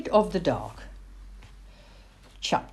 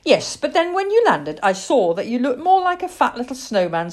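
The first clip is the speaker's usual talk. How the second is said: cat's reply; 8 semitones higher, 5 dB louder than usual. Acted.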